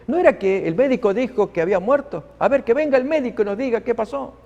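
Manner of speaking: a hint of puzzled complaint; complaining relatives. Relatives grumbling among themselves, mildly voiced. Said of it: "quejándose entre ellos".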